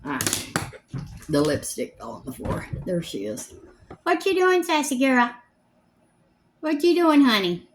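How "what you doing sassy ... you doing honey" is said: high, loud sing-song pet talk to the dog. Real, not acted. Owner calling her dog Sassy, in high baby talk. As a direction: coo up high at the little dog.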